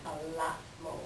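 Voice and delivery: ordinary talk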